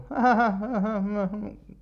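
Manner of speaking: high mock sitcom laugh track; canned laughter imitation. Comedian mimicking a sitcom laugh track, high canned laugh. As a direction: cackle like a canned audience.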